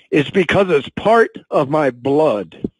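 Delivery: slow, halting, emotion catching in the throat; fighting back tears while speaking slowly; choked up. Real, not acted. Sales director telling his company story, voice choking up. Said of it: "I'm getting a little choked up".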